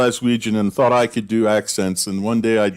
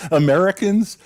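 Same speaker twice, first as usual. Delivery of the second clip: laughing; 9 semitones higher than usual. Real, not acted. Speaker laughing while starting a point to friends. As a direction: laugh through the word people.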